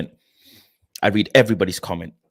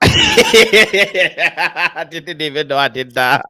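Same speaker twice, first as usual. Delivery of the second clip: laughing; 6 semitones higher, 7 dB louder, much slower than usual. Real, not acted. Laughing at funny chat comment.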